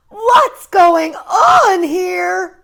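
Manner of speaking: very slow, high, loud cry; a hammed-up shocked mother; cried out. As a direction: mom cries out in shock. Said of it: "cried Mom".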